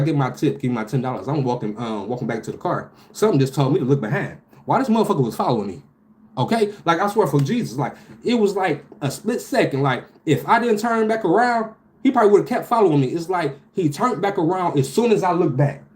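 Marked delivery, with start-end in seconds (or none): 4.7-15.8 higher, rushed and keyed up; a spooked rush, pitched up; alarmed retelling. Real, not acted. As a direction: tell it like it still scares you. Retelling being followed, alarmed.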